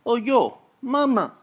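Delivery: high Italian-American son voice, calling to mama; son in the Prego ad. Retold or imitated. Son calling to mama at the stove, high Italian-American voice. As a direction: chirp it up high like a hungry kid in an old ad. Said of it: "He goes".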